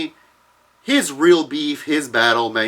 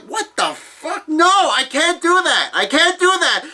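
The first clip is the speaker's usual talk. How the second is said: mock outrage. Viewer outraged that the episode ends. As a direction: wail at the cliffhanger like a betrayed fan.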